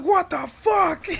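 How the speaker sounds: high, slow startled cry; shocked. Retold or imitated. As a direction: gasp it out in shock. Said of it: "I'm like".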